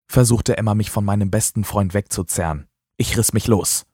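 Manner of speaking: ordinary delivery, nothing marked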